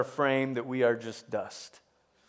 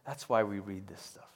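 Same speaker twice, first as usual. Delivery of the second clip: ordinary talk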